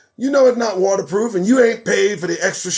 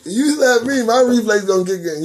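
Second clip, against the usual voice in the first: high-pitched start through laughter; laughing; 4 semitones higher, 3 dB louder than usual. Real, not acted. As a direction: crack up while making the boast. Cracking up over his own reflexes.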